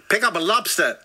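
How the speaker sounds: put-on botched South African accent that sounds American; accent slipping into American.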